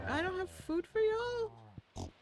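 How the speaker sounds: high, slow cooing; high sweet pet-talk. Real, not acted. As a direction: coo to the hungry babies. Coos to hungry animals.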